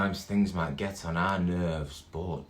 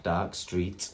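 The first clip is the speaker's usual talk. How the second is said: ordinary delivery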